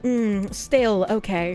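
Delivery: a hint of a snooty, condescending waiter; mock snobbish waiter repeating the order. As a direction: look down your nose and drawl it a little. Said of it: "looked down at me and he was like".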